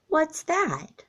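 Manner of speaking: put-on high, curious child's question; a small kid perking up; little raccoon voice. A narrator's reading. Chester asks his mother, high and curious.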